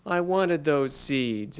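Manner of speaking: slow, high, drawn-out whine to mom; acted crying. A narrator's reading. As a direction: wail it mildly, stretching the words. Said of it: "Jack began to cry".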